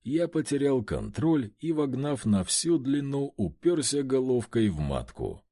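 ordinary delivery, nothing marked

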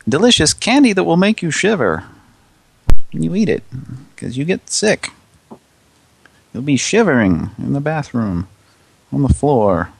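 high, hammed-up commercial read; mock ad announcer. Spoofing a Halloween candy ad, mock announcer. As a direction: sell it with exaggerated old-radio pep.

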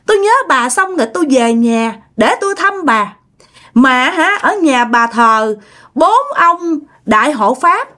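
put-on high, snapping voice of a dead girl; possessed girl's voice. Possessed girl snapping at her own mother, high. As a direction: bark it back at the mother, up high. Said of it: "giọng của con bé Hoa".

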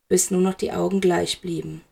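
plain reading voice

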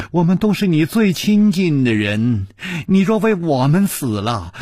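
恳求; imagined plea. Retold or imitated. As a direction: beg him to die for them. Imagined pleading voice.

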